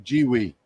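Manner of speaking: both syllables of Jiwi rhyme with "see"; Jiwi said as jee-wee. Shown as a demonstration. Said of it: "they pronounce it".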